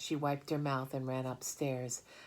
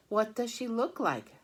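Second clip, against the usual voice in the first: worried mother; 10 semitones higher, 7 dB louder than usual. Acted. Mother questioning.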